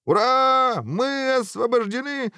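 very high, drawn-out cheer; jubilant exclamation. A narrator's reading. Guard hearing the ogre is dead, jubilant. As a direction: cry out in gleeful relief. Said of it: "воскликнул стражник".

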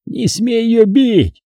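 high, slow, voiced mock hiss; hissed warning. A narrator's reading. Vakha defends his sister at knifepoint, hissed warning. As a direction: warn him off slowly in a high, tense voice. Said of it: "зашипел он".

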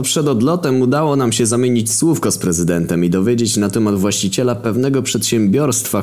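ordinary delivery

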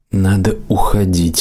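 ordinary delivery, nothing marked

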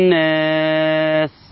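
very slow "الناس" as an-naas with long held nasal ن; assimilated lam. Shown as a demonstration. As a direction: hum through the doubled ن in "الناس", no L. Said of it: "اللام أتى بعدها حرف النون يتم إدغامها".

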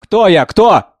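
high, loud bitter cry; bitter anguish. A narrator's reading. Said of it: "сказала Анна с горькой тоской".

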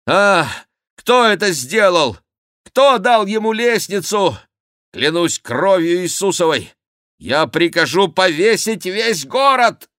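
high, loud royal rage; furious outburst. A narrator's reading. King Henri demands the culprit, furious high shouting. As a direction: thunder threats at everyone present. King rages, threatens to hang city.

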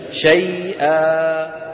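slow chanted شيئا ending in a long a; reciter's stop on شيئا; pausal form. Shown as a demonstration. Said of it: "كيف نقف على شيئا".

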